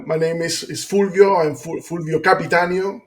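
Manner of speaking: ordinary talk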